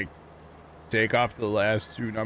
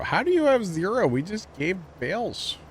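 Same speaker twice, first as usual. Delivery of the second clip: surprised; 8 semitones higher than usual. Real, not acted. Bot failed to give bales.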